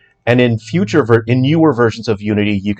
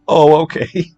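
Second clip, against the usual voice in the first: higher-pitched mock let-down; imitated upset cashier; 7 semitones higher, slower than usual. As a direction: play the crestfallen clerk giving in. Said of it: "he was so upset".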